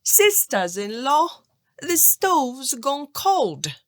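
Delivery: lazy lad calling to his sisters-in-law from the stove. A narrator's reading. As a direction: shout lazily from the stove top. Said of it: "and called".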